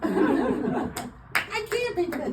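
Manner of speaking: high, slow, pleading falsetto; distressed damsel. Pretend. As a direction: wail it like a helpless heroine. Melodrama damsel bit.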